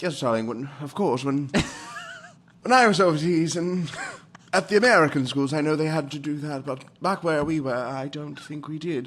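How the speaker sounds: airy transatlantic "darling" affectation; posh transatlantic. Retold or imitated. Co-host mimicking friend's overseas stories, posh transatlantic accent. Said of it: "There's just a poshness".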